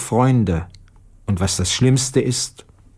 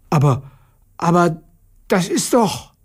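astonished; 6 semitones higher than usual. Acted. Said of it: "rief erstaunt".

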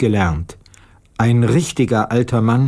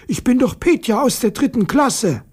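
high schoolboy voice, insisting; a third-grader setting it straight; insistent boy; 11 semitones higher than usual. Acted. Petja insists to Aunt Natascha, high boy voice.

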